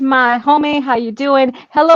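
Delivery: normal talking voice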